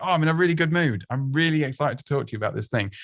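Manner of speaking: eager to share news, fast but not over the top; natural excitement. Shown as a demonstration. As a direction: demonstrate a really good mood. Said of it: "however you sound excited".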